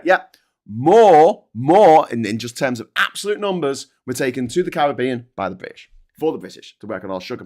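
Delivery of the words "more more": MORE, MORE hit hard and loud; punched repetition. Real, not acted. Ranting to viewers about the Caribbean, loud punched words. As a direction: slam each "more" down. Hammering home the slave numbers.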